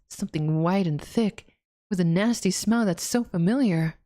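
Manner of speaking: ordinary delivery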